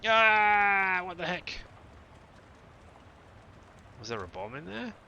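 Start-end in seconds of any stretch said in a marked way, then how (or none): 0.1-1.5 high, loud startled ah; startled cry. Real, not acted. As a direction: jolt at the blast. Luggage explodes.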